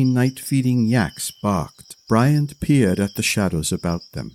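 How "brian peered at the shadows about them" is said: ordinary delivery, nothing marked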